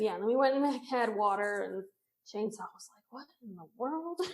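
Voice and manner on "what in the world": baffled. Retold or imitated. Friend baffled by all the yelling.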